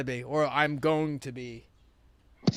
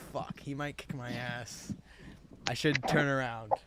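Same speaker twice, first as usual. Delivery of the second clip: muttered inner thought; 4 semitones lower, 9 dB quieter than usual. Retold or imitated. Son's inner thought, scared of dad.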